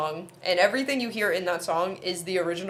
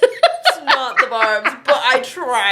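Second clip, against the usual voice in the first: higher and louder, laughing; laughing defensively; 7 semitones higher, 8 dB louder, slower than usual. Real, not acted. Defending her Arabic to her friend, laughing. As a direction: protest through giggles.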